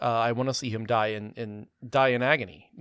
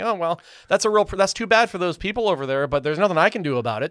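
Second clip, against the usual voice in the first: higher, louder mock-resigned viewer; mock viewer shrug; 6 semitones higher, 6 dB louder than usual. Critic mocking a viewer's defeated shrug, higher and louder. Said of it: "you leave this theater thinking".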